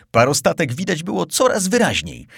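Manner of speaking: ordinary delivery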